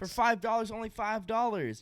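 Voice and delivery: high, hyped salesman voice; mock sales pitch. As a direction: sell it like a cheesy ad.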